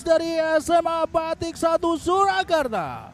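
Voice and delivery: high, loud ecstatic goal cry; goal call. Real, not acted. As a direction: scream gol over and over.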